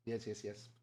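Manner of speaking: ordinary talk, nothing marked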